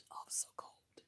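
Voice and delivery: hushed whisper, barely any voice. Retold or imitated. To her viewers, remembering the cold while sick, whispered. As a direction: breathe it out like you're still freezing. Retelling being sick and freezing.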